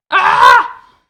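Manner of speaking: sudden high, very loud scream; short piercing shriek. Real, not acted. Gamer startled while digging down, loud scream. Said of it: "Sorry, that was loud".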